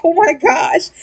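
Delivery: laughing. Real, not acted.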